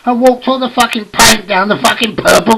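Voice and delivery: high, loud ranting delivery; mock-outraged yell; exasperated punchline. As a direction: build to a shouted punchline. Punchline of the carpet disaster.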